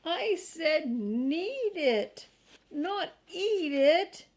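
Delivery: angry shout. A narrator's reading. Boy shouts at his dog for eating the flower, angry. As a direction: yell at the dog, stressing need and eat. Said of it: "shouted Henry".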